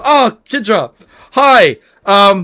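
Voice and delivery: high, loud awkward greeting; Bong's voice. Acted. Bong awkwardly greeting Kidra in a high voice. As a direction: caught off guard, say hi. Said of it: "He goes".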